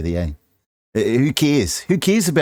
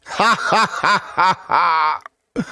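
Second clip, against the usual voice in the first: fake laugh; 7 semitones higher, slower than usual. Mock laugh after joke.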